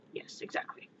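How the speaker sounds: hushed, strained disguise voice; Batman. Acted. Masked hero explaining his odd sound, strained whisper. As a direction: whisper hoarsely to hide your identity. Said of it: "So you strain your voice".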